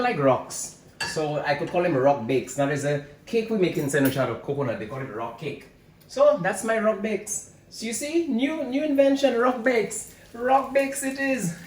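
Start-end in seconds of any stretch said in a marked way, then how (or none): 6.1-11.6 proud high announcement. Real, not acted. Proud high naming of invention.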